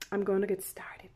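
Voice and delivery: breathy whisper as the reading begins; softly whispered aside to viewers. Real, not acted. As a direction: hush it down to a whisper. Drops to whisper before starting.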